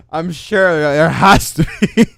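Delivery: real laughter breaking through the words; high-pitched, slow, cracking up mid-sentence; laughing. Real, not acted. Streamer reacting to an absurd quote, laughing as they talk.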